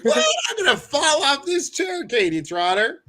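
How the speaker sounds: high, loud, giddy disbelief; thrilled exclaiming. Real, not acted. Host overjoyed to see a friend in the chat, high giddy exclaim.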